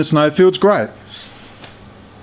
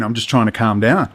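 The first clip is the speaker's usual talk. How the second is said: ordinary talk, nothing marked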